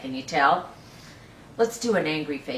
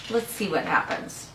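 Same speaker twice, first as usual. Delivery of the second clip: normal talking voice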